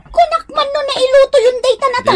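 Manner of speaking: calling out. Acted. Wife calls out to husband.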